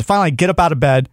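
ordinary talk, nothing marked

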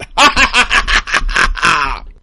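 loud villainous cackle; evil laugh. Retold or imitated. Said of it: "Or a laugh to that effect".

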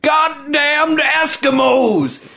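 high, slow yelled Texan drawl; Texas accent. Retold or imitated. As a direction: holler it like an angry cowboy. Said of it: "terrible Texas accent".

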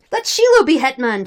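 loud, forceful nomination; shouted. A narrator's reading. Some Cossacks proposing a new leader, shouted. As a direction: call it out to the assembly. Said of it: "shouted some".

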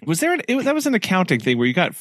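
high, fast, eager recall; excited questioning. Real, not acted. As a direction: rushes the memory out, pitched high. Excited half-remembered question.

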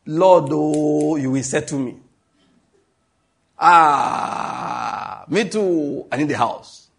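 pleading mock prayer with long drawn-out cries; mock Gentile prayer. Preacher imitating Gentiles praying for money and a house, mock pleading. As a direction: draw out the cries as if begging for things. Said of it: "This is how they pray".

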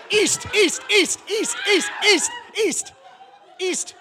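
high, loud, rapid-fire repeats; frantic loud steering; urgent calling out. Real, not acted. Urgently calling out map directions.

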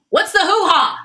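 high, loud, blurted-out question; loud mock query. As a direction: imitate someone calling out a question for all to hear. Director loudly overhearing private talk.

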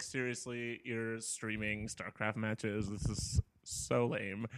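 quietly unimpressed, imitating past irritation; annoyed. Complaining at a roommate blasting StarCraft casts, quietly annoyed. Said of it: "I would get really annoyed".

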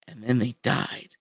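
low, hushed mock-mournful line; understated parody of solemn grief; mock sad. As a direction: drop low and soft, imitating a tragic moment. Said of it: "like, a sad sequence about them dying".

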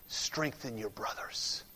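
near-whisper, low and very soft; hushed awe. Real, not acted. Preacher moved by the verse, hushed. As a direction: let it sink to a reverent whisper.

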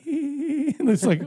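high, slow words broken by laughter; laughing through words. Real, not acted. Teller retelling the awkward joke, laughing through words. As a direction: talk while cracking up. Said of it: "I started laughing".

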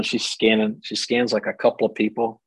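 ordinary delivery

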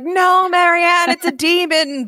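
high, slow mock warning cry with a laugh; half-laughing pretend yell at Marianne; mock scream. Listener pretending to warn Marianne about the demon cat, mock scream. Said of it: "I was about to scream".

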